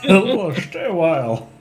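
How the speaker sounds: slow, wobbly high character voice; exaggerated, drawn-out old storyteller impression; Deckard Cain. Streamer throws out a requested game-character impression, drawn-out and high. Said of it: "throw a little Deckard Cain".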